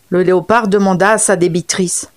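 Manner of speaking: plain reading voice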